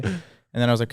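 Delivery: normal talking voice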